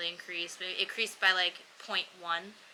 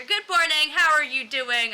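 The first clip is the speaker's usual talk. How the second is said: booming, much higher mock doctor greeting; loud male doctor; 7 semitones higher, 11 dB louder than usual. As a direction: imitate a loud, blunt doctor waking you. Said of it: "but just male doctor".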